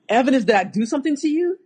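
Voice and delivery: high, fast disbelieving retort; incredulous. Retold or imitated. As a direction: act stunned he would even say it. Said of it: "I'm like".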